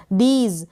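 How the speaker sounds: "दीज" with a long ee; "दीज" with drawn-out vowel and z ending. Shown as a demonstration. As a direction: stretch the ee in "दीज". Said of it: "long ई का उच्चारण होगा".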